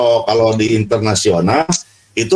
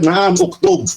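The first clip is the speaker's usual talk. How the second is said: "Naam, uktub" as a teacher's raised-pitch yes; confirming intonation; 7 semitones higher, slower than usual. Acted. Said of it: "terus kata si ustaz".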